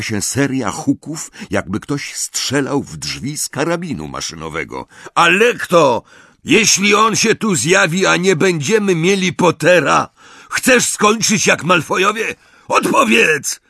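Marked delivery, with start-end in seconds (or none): 5.1-13.6 loud, high, furious bellow; roaring. A narrator's reading. Amisius bangs on the door at his sister, roaring. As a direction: roar threats through the door. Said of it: "ryknął Amisius".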